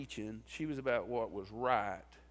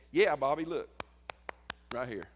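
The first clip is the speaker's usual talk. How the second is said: loud, eager burst that settles down; imitating someone proud and eager; proud showing-off; 7 dB louder than usual. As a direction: show it off proudly, loud at first. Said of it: "he was proud of that badge".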